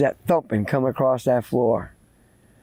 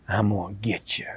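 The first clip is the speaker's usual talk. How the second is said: low, hushed threat; quiet menace; 6 semitones lower, 7 dB quieter than usual. Acted. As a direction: sink it low and ominous. Ghost threatens the boy.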